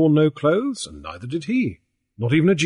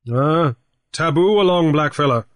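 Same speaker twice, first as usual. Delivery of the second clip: raised, lively pidgin English; Grief's pidgin voice; 4 semitones higher, 4 dB louder than usual. A narrator's reading. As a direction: talk big to the old chief in pidgin. Grief refuses Coho in pidgin.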